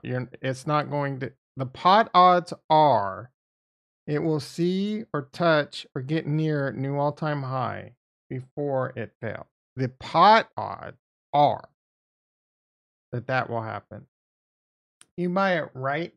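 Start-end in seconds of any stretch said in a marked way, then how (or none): 1.5-3.3 pot odds slow and heavy; stress on pot odds. Shown as a demonstration. Streamer lectures chat, slow heavy stress on pot odds. 9.8-11.7 pot odds slow and heavy; stress on pot odds. Shown as a demonstration. Streamer lectures chat, slow heavy stress on pot odds.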